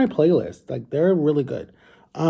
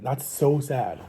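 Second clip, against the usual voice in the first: ordinary talk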